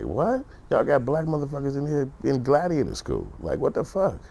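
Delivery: high-pitched mock disbelief; incredulous outburst. Reacting to judges sparing them prison, high incredulous outburst. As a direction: jump high in baffled, put-on outrage. Mock outrage at lenient sentencing.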